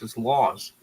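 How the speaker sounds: ordinary talk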